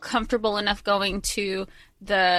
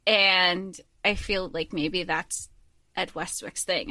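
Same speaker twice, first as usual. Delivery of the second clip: normal talking voice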